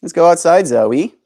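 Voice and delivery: high sing-song pet talk. Acted. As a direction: coax a pet gently. Coaxing pet bird outside.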